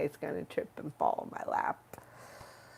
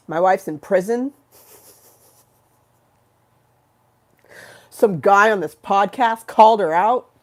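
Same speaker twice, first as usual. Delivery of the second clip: loud menacing tough voice; a loud menacing figure threatening the podcaster; threatening character; 3 semitones higher, 9 dB louder than usual. Pretend. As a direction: warn them someone's gonna pay.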